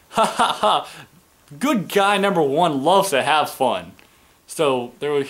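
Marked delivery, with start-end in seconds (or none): 0.1-3.9 high, slow cheesy announcer voice; sing-song mock commentary, drawn out; mocking Michael Cole. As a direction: ham it up like a corny commentator. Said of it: "it's always".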